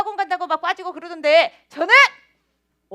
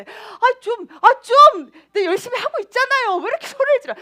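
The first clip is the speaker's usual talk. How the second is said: high-pitched marital squabbling; whiny, raised-voice protest; exasperated quarrel; 8 semitones higher, 6 dB louder than usual. Retold or imitated. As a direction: snap back at a shouting husband, acted out. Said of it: "진짜 부부싸움 대판 나는 줄".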